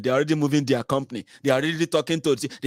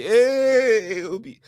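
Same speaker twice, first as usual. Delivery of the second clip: mock cheer; 9 semitones higher, 4 dB louder, much slower than usual. Cheering Obi in retold scene.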